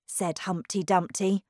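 usual speaking voice

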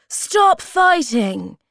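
slow, high, loud command; crying out. A narrator's reading. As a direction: order the children loudly to stop. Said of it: "she cried".

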